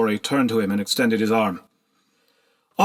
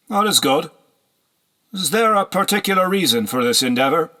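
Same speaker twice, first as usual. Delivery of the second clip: higher, louder, smug interest; sly nobleman; 7 semitones higher, 3 dB louder than usual. A narrator's reading. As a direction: probe with false friendliness. Horseman pleased, probing.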